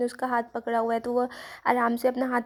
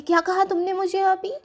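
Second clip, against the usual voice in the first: higher-pitched, startled retort; shocked question; 7 semitones higher, 4 dB louder than usual. Retold or imitated. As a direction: ask in startled disbelief, pitch lifting to the end. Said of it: "हेंजी चौंक जाती है". (चौंककर पूछा गया सवाल)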